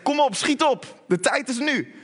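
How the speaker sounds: high, pressing call to move; urgent hurrying. Acted. Preacher as Jesus urges Zacchaeus down now, urgent hurrying. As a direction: hurry them along with insistent energy. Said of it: "Haast u, hij zegt niet".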